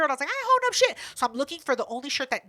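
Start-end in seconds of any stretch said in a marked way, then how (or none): 0.3-1.0 very high indignant denial; defensive retort. Retold or imitated. Answering Keela about holding up the line, indignant.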